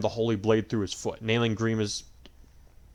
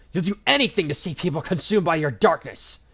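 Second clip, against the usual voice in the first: angry roar; 7 semitones higher, 8 dB louder than usual. A narrator's reading. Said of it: "Marth roared angrily".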